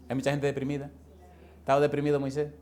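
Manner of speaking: ordinary delivery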